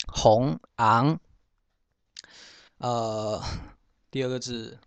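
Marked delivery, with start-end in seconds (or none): 0.8-1.2 昂 said as Hokkien "âng"; Hokkien reading. Shown as a demonstration. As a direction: say 昂 as a rising "ang" with no initial consonant. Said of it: "念成".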